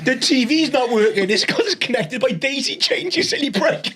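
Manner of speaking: laughing. Retold or imitated. Mock scolding husband, laughing.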